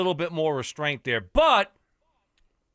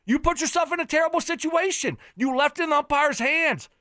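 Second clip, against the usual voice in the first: raised, fired-up tirade at the coach; heated rant; 8 semitones higher than usual. Real, not acted. Sports host ranting at a college coach, high and heated. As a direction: lay into him, getting hotter.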